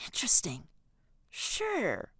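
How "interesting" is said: hushed reply; whispered musing. A narrator's reading. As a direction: whisper it, intrigued. Hushed exchange in class.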